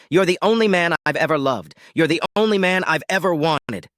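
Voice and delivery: pleading declaration. Acted. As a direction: profess your love to win him back. Wife begs after calming herself.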